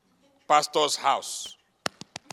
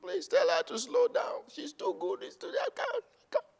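mock pleading husband; 13 semitones higher than usual. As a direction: beg the pastor in a high whine. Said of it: "the person on the other side say".

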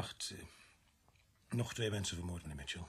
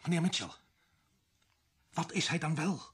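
higher, louder urgent pleading; urgent call; 5 semitones higher, 6 dB louder than usual. Acted. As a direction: call him back before he slips away. Sergeant urges dying man.

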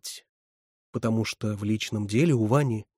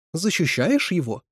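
slow, high threatening question; menacing; 6 semitones higher, 3 dB louder than usual. A narrator's reading. Резина challenges Катерина for defending him, menacing. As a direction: stretch the question into a warning. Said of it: "переспросила с угрозой Резина".

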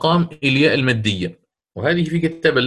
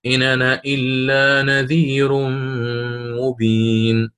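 Warsh recitation; slower than usual. Shown as a demonstration. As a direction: chant the verse in Warsh's reading. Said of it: "أعيد". (تلاوة برواية ورش)

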